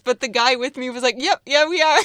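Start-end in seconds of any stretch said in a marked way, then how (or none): 1.2-2.0 a hint of a British accent, loud and high; cheeky British actor voice; mock British, bluffing fans. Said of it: "doing our English accent or British".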